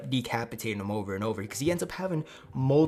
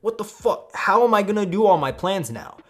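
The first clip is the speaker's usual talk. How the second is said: high, loud, exasperated outburst; hammed-up villain tantrum; furious; 11 semitones higher, 11 dB louder than usual. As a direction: yell as if your whole scheme just collapsed. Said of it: "Obito is, like, furious".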